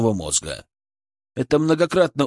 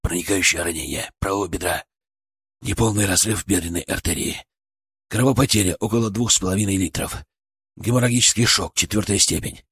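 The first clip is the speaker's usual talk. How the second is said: hoarse voice; 4 semitones lower than usual. A narrator's reading. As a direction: report with a torn, hoarse voice. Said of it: "хриплым, сорванным голосом".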